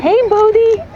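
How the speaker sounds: high, loud greeting. Real, not acted. Saying hi to Bodhi the dog, high loud coo.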